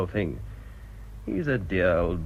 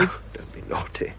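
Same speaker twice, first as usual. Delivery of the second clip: playful scolding under the breath; hushed rebuke; 3 semitones lower, 4 dB quieter than usual. Acted. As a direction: shush her so the old woman won't hear. Vicar hushes her near Miss Chant.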